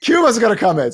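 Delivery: high, loud mock panic; mock alarm. Host mock-warns listeners Cuba is coming, in hammed-up alarm. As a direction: sound the fake alarm, hammed up.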